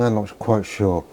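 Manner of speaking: ordinary talk, nothing marked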